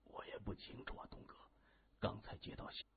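very soft, breath-only answer; whispered reply. A narrator's reading. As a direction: confide it in a whisper. Whispered reply to boss's secret question. (悄声回答)

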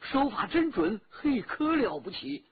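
excited, admiring rush of assent; hasty agreement. A narrator's reading. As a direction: jump in quickly to agree, impressed. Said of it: "孙老三忙说".

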